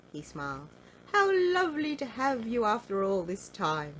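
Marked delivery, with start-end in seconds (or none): 1.1-3.9 glad welcome. Acted. Mister Greedy welcomes cousin.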